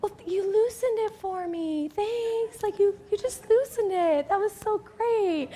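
put-on placating girl. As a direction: softly coo thanks, hammed up. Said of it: "then the girl's like".

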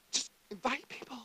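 whispered, secret-sounding; conspiratorial whisper. Real, not acted. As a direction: lean in and whisper it. Aside to the congregation.

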